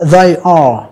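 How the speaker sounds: THEY ARE as two separate full words; careful, complete "they are", both vowels clear; full form. Shown as a demonstration. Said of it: "chúng ta đọc đầy đủ".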